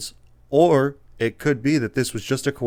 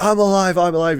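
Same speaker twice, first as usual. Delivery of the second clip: high, raised mock cry for help; acted plea, pitched up; urgent cry; 9 semitones higher, 4 dB louder than usual. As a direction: call out urgently as a survivor. Acts out survivor crying out.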